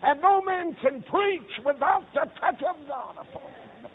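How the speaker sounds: high, loud, drawn-out proclamation; ringing pulpit cry; climactic preaching. Acted. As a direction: holler it slowly, falling away at the end.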